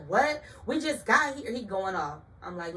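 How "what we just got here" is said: indignant. Retold or imitated. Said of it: "He like".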